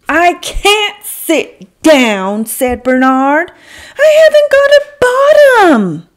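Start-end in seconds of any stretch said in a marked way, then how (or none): 0.1-2.4 slow whispered wail; a hint of a despairing robot; whiny protest. 4.0-6.1 very high, loud wail; despairing wail. Acted. Robot despairs.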